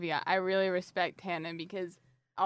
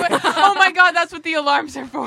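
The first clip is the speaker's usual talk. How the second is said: louder, higher, laughing through words; 5 semitones higher, 10 dB louder than usual. Real, not acted. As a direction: crack up mid-sentence. Player cracks up at joke.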